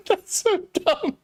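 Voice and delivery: high, laughing through it; laughing at herself. Real, not acted. Streamer to chat, laughing at her own mistake. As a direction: laugh at your own blunder.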